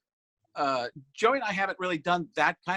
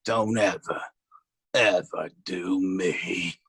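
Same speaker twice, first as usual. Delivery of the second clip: Stephen A Smith; 11 semitones lower than usual. Retold or imitated. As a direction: drop deep and drag out the last words. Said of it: "and he goes".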